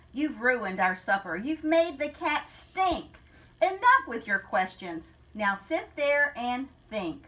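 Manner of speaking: rhythmic storybook read-aloud; story narration. Acted. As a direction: read the rhyme to the class with a lilt. Teacher reads picture book aloud.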